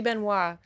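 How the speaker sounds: "Benoit" said ben-WAH; "Benoit" with silent T; French pronunciation. Shown as a demonstration. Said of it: "with silent S or T".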